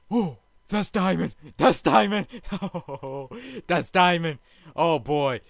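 bursting with joy at the find; thrilled. Real, not acted. Spots diamonds in the mine.

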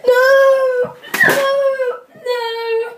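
high, loud cries of no; despairing shout. Real, not acted. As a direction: wail it out in despair. Lost rock paper scissors.

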